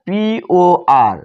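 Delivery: পিওআর spelled out letter by letter, very slowly; slow spelling. Shown as a demonstration. Teacher to students spelling a word, slow and deliberate. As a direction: stretch each letter of পিওআর for the class. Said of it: "তাহলে পর।".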